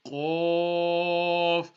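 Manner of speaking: Arabic Qaf from the throat; thick Qaf. Shown as a demonstration. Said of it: "pronounced from the top of the throat".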